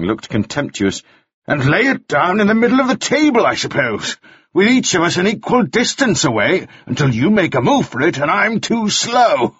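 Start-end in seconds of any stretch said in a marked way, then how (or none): 1.5-9.5 raised, scornful mockery; sarcastic. Mannering mocking Gascoigne's proposal, sarcastic. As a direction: sneer at the idea.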